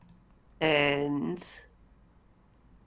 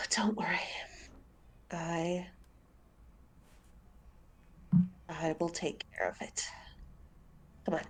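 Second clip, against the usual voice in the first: much softer, quick and hushed; soft reassurance; 12 dB quieter, faster than usual. Acted. Zen calming his weeping friend in a soft, hurried hush. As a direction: comfort him under your breath.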